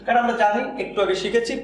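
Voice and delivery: ordinary talk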